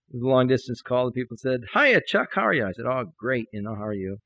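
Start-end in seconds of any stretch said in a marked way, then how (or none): 1.7-2.7 high, bouncy phone greeting; cheery caller. Retold or imitated. Long distance caller greeting Chuck, cheery. 2.9-4.2 low, soft, half-hearted phone reply; discouraged. Retold or imitated. Discouraged preacher answering a caller, half-hearted. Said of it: "I was just really discouraged".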